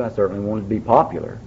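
ordinary talk

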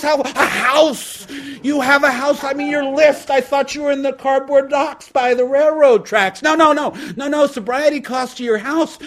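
very high, loud fake shock and fake sorry; mock-shocked God. God adding the job to the bill, shouting high. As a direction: gasp at the news, then cheerfully take it. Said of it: "And God goes".

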